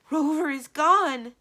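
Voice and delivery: loud, high, drawn-out wail; anguish and disbelief. Acted. Witch finding her familiar's matchbox empty, anguished disbelief. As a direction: cry it out slowly in anguish. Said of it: "in a voice so full of anguish and disbelief".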